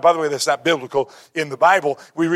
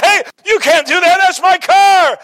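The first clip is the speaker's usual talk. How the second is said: much higher, loud outraged yell; mortified shout; 13 semitones higher, 7 dB louder than usual. Retold or imitated. Young driver shouts at the lady who wrecked his Corvette, outraged. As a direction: cry out as your car gets smashed. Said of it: "And he was mortified".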